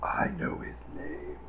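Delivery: ominous low whisper. Acted. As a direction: breathe it like a secret.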